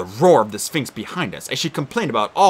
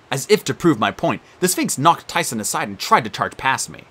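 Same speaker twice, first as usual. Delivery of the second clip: plain reading voice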